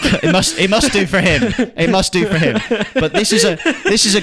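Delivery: high laughing words. Real, not acted. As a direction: laugh through the line. Laughing at [name] drug joke.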